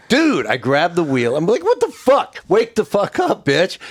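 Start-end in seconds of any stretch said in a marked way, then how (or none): 0.1-0.5 sudden high alarmed yell; shouted dude. Retold or imitated. Storyteller yelling at his sleeping driver, alarmed shout. 1.6-3.8 high, loud alarmed shouting; panicked yell. Retold or imitated. Yelling at the driver who nodded off at the wheel, high alarmed shout.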